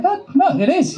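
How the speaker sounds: high squeaky "Oh, no, it is"; mock insistence. Insisting in retold argument.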